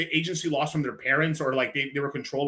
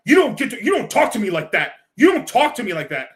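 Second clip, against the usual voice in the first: higher, louder mock outrage; imitating an indignant customer, higher and louder; 6 semitones higher, 7 dB louder than usual. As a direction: play the offended customer, puffed up. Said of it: "'Cause it's like".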